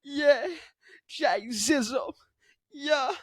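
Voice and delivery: slow, squeezed rapper voice. Acted. Host doing a rapper impression, slow and put-on. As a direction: draw it out like a goofy rapper parody. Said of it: "Jay-Z impression".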